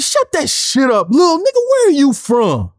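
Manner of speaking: acting out an exasperated confrontation; fed up. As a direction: drag out the angry demand. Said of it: "He's fed the fuck up".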